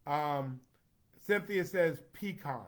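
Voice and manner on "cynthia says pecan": ordinary delivery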